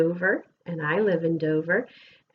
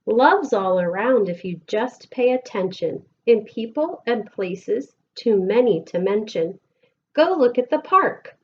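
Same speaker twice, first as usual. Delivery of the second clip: lilting, sing-song picture book reading; gentle storytime voice with bouncing rhythm; reading to children; 4 semitones higher, 5 dB louder than usual. Acted. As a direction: read the rhymes aloud to a class of kids. Reads picture book to class.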